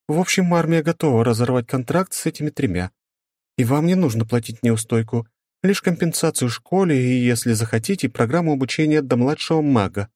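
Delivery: a school directress explaining; woman's voice. Acted. Directress reports.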